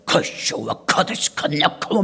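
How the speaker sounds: slow low babble in tongues; 커쇼와 카데시카냐카모 drawn out in a low, slow strange tongue; imitating tongues. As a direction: mimic the deaconess praying in tongues, slow and low. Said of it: "막 이래".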